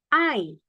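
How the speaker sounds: "I" said as the diphthong ah-ee; E-I read AI. Shown as a demonstration. Teacher answering how E I reads, clear ah-ee glide. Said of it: "bacanya apa?".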